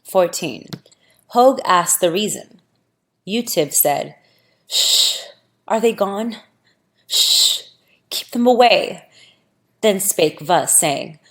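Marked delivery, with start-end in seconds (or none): 4.7-9.1 frightened hush. Acted. Utiv hiding from the spirits.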